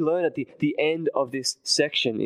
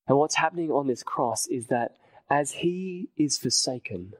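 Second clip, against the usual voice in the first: usual speaking voice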